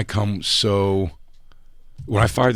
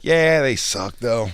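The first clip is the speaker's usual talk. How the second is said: slow, loud drawl of dismissal; imitating Patrice; 4 semitones higher, 4 dB louder, slower than usual. Patrice shrugging off the managers, slow. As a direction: shrug it off slowly. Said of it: "He's like".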